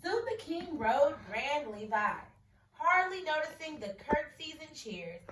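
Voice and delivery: expressive picture-book read-aloud; story reading. A narrator's reading. Teacher reading a picture book to her class.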